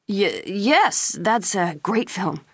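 stammered start, slowing as it goes on; flustered stammer. A narrator's reading. Flustered clerk answers the woman he thinks is flirting, stammering. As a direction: stumble on the first word, then push it out. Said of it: "when he could speak".

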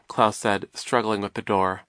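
ordinary delivery

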